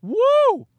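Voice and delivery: high, joyful woo; whooping cheer. Real, not acted. Whooping to listeners about a post-game beer. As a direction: let out a big whoop.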